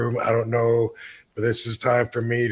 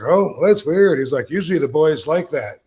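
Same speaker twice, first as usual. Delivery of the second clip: high mock surprise; 4 semitones higher, 5 dB louder than usual. Uncle's remark, retold.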